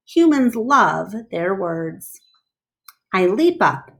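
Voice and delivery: usual speaking voice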